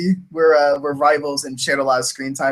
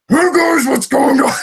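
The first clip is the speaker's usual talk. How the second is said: gruff, high, loud shouted character voice; Knife; 10 semitones higher, 6 dB louder than usual. Shown as a demonstration. Voice actor showing how gruff the character goes, very gruff voice. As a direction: push the gruffness as strong as it gets. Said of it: "how gruff he goes".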